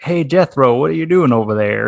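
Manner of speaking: playful American Southern accent. Shown as a demonstration. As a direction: call out to a neighbour with a Southern US twang. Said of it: "you could say something like".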